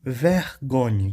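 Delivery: slow demonstration of "Vergonha" with French final "nhe"; French GN sound. Shown as a demonstration. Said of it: "é o som de ni".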